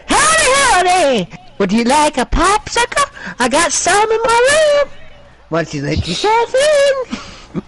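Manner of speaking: high, slow, loud cartoon creep; Herbert the Pervert. Cartoon creep invites people at his door, slow high impression. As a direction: draw it out in a creepy high falsetto. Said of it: "we made him do Hermit the Pervert".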